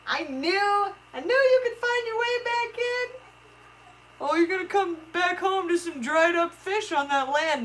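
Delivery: very high, soft cooing. Real, not acted. Owner cooing at her pet fish, high and soft.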